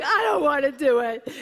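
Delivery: put-on high, loud childish whine; whiny refusal. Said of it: "And I was like".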